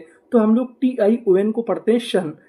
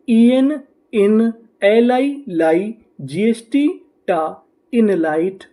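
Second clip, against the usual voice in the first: slow sounding out; much slower than usual. Shown as a demonstration. Teacher to students, sounding out each code slowly. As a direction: spell each piece out carefully. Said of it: "तो हमारी meaning क्या बनी?".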